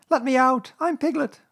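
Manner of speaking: very high, loud plea; Piglet crying out. A narrator's reading. Piglet begging to escape the bath, crying out. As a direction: cry for release in a squeaky voice. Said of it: "cried Piglet".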